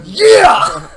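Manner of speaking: huge high-pitched yell; cheering yell. Real, not acted. Cheering a friend's spelling, huge high yell. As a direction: whoop it at full volume.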